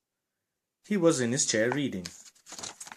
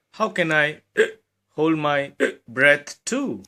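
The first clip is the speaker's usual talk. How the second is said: small bear voice; 4 semitones higher, 6 dB louder, slower than usual. Acted. Little Bear asks, small bear.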